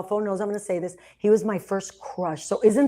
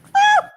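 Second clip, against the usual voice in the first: very high, loud shriek of surprise; delighted shocked scream; surprised shriek; 8 dB louder than usual. Real, not acted. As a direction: squeal as if caught out. Told she was his supervisor.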